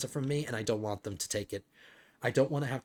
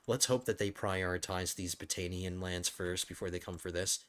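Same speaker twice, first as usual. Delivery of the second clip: ordinary talk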